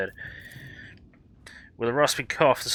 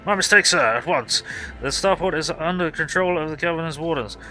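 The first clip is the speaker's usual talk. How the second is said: higher, louder snap to attention; barking sergeant; 5 semitones higher, 3 dB louder than usual. Acted. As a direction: report like a startled soldier. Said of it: "and barks".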